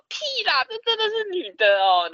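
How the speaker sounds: mock high excited disbelief; excited streamer. Streamers convinced.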